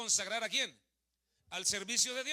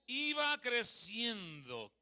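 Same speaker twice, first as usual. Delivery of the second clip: drawn-out fill-in; 2 semitones higher, 4 dB louder, much slower than usual. Shown as a demonstration. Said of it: "¿qué cosa?".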